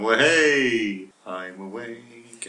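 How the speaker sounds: slow mock whoop that tails off; mock drunken revival. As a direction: imitate a drunk perking up, stretched right out. Mimics drunk friend waking on whiskey.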